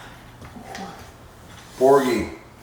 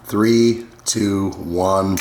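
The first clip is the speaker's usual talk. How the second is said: ordinary delivery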